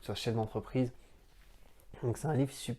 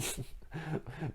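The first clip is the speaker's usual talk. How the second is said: a quick, soft real laugh; 3 semitones higher, 10 dB quieter than usual. Real, not acted. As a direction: laugh at your own joke. Laughing at own joke.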